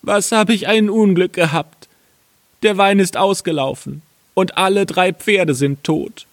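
high-pitched tale of woe; lamenting carter. Acted. Carter telling his wife of losses, high wailing. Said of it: "sprach er zu seiner Frau".

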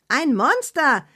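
high excited exclamation; Annabella exclaiming. Acted. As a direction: announce the monster with relish, high. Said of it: "rief Annabella".

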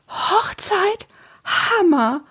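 very high, awed gasp of words; breathed out. A narrator's reading. Antonia hears of the wedding, breathing in awe. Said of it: "hauchte Antonia".

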